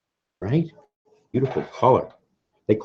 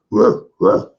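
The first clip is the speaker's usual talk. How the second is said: mock snort; 11 semitones higher, 5 dB louder than usual. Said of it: "They go like".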